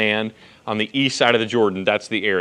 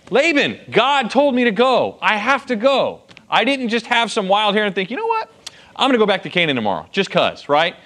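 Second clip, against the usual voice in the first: higher, pleading explanation to Laban; earnest Jacob; 7 semitones higher than usual. Retold or imitated. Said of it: "Who told him to go home".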